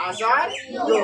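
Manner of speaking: আচার্য said as a-sa-ri-yo; j turned to y. Shown as a demonstration. Teacher correcting how it is pronounced, riyo ending on আচার্য. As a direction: end আচার্য in "riyo", not "rjo". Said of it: "আচার্য উচ্চারিত হবে না".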